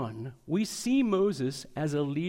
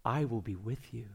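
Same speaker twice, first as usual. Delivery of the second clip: hushed gentle reassurance; gentle voice of God; 6 semitones lower, 6 dB quieter than usual. Acted. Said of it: "the gentle voice of God".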